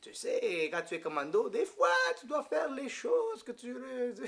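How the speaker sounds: put-on French accent climbing high toward laughter; imitated French-accented priest. Commando priest confessing to a groom, imitated French accent, climbing high. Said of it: "He's going like this".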